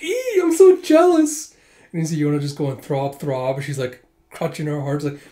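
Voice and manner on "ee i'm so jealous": much higher, louder and slow, a swooning squeal; squealing fangirl. Acted. An admirer watching Huck hug a customer, high swooning squeal. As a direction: squeal it like a jealous fan.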